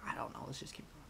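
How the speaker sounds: whispered aside. Real, not acted. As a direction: whisper it to yourself.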